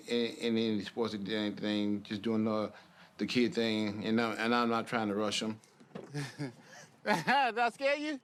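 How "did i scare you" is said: high, sing-song voice for toddlers. Real, not acted. Father talks to toddler daughters.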